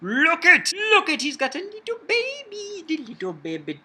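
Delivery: high, cute cooing voice. Real, not acted. Cooing over the baby dinosaur.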